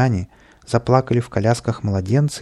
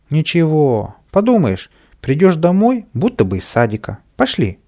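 higher, louder, breezy imp egging on; cheeky sprite talking the boy into mischief; carefree urging; 6 semitones higher, 5 dB louder than usual. A narrator's reading. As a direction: wave his fear away with a grin. Kapriska brushes off worry.

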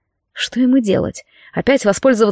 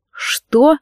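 curt and muffled; 5 semitones higher than usual. Acted. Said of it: "коротко и глухо спросил он".

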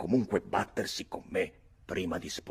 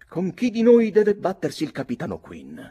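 put-on high, loud young hothead demanding a duel; eager challenge; 12 semitones higher, 11 dB louder than usual. Young Redmond to his cousin, high and loud. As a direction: fire it off like a proud boy ready to fight.

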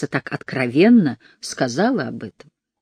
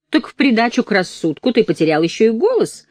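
sneering taunt; 7 semitones higher, 4 dB louder than usual. Acted. Counselor taunting.

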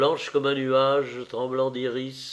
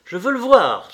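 louder, higher, insistent demand; urgent cry; 4 semitones higher, 6 dB louder than usual. A narrator's reading. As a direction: cry out urgently, building in pitch and force. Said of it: "s'écria-t-il". (cri pressant, de plus en plus fort)

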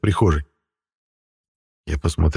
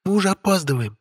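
high, light voice of a spoiled beauty; haughty young woman voice; 10 semitones higher, 5 dB quieter than usual. Acted. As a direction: play the capricious witch, higher. Witch Stella speaking. (капризная молодая ведьма)

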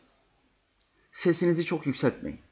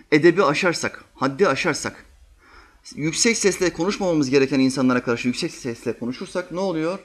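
usual speaking voice

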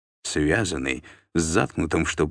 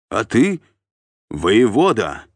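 loud, raised-pitch bark; barked correction; 5 semitones higher, 7 dB louder, slower than usual. A narrator's reading. Sergeant correcting the captive's address, barked. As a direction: snap the title at him like a sergeant. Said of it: "рявкнул десятник".

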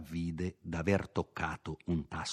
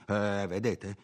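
peevish; 2 semitones higher than usual. A narrator's reading. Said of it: "con voce stizzosa".

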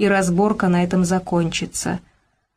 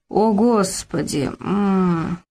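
slow, drawn-out mumbling groan; sleepy groan; much slower than usual. A narrator's reading. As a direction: moan it half asleep. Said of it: "промычал Фёдор Иванович". (сонно мычит)

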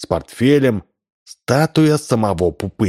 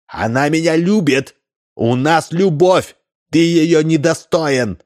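shouting; 7 semitones higher than usual. A narrator's reading. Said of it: "он закричал в ответ". (отчаянный крик, выше и медленнее)

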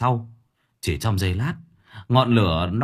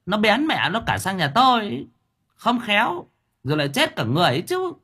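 much higher sarcastic jeering voice; snide mockery; 11 semitones higher than usual. Đốp jeers at Thưởng Báo over the fire, high sarcastic voice. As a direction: needle him with mock sympathy. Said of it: "con Mụ lại nói xéo".

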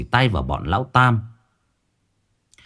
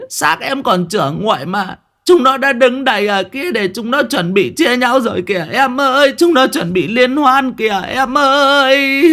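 hammed-up weeping for the onlookers; whimpering lament; 11 semitones higher than usual. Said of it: "Mụ lại mếu máo".

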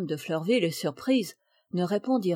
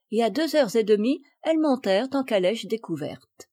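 ordinary delivery

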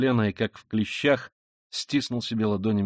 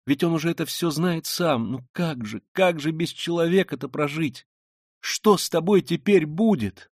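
high-pitched, pleading lament; anguished Sonya; 6 semitones higher, 3 dB louder than usual. Acted. Sonya fearing for the killer, anguished.

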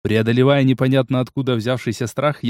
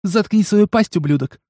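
angry woman; 8 semitones higher than usual. Acted. Amazon snaps at prisoner.

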